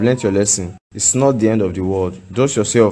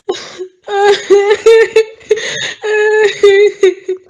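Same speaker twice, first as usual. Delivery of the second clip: very high, loud keening wail; howling sob of a bereaved mother; 6 dB louder than usual. Acted. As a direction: wail in raw grief. Crying over daughter's death.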